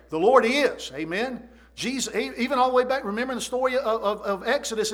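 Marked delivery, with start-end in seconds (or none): none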